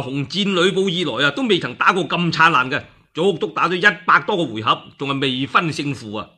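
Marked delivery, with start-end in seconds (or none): none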